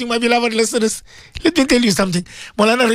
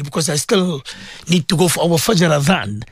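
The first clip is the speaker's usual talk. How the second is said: ordinary talk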